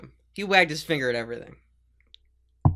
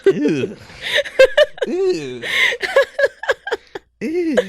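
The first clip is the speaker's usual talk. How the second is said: laughing disgust; 5 dB louder, much slower than usual. Real, not acted.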